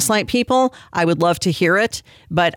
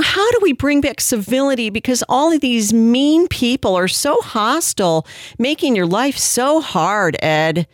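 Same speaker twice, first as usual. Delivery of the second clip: mocking sympathy; 4 semitones higher than usual. Host parodying an interviewer's question to the pastor, mock sympathetic. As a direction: whine the question with fake pity.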